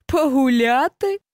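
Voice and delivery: slow taunting question, scoffing at the word; mocking. A narrator's reading. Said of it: "перепитав глумливо заєць".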